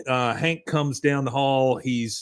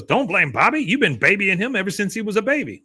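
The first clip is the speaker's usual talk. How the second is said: put-on higher, scornful old man; scolding grandpa; 7 semitones higher than usual. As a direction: scold him like a gruff father. Cotton scolding Hank.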